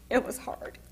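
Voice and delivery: soft, tear-filled voice breaking; choked-up crying. Real, not acted. Telling how her mother saved her, quietly crying. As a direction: fight through real tears.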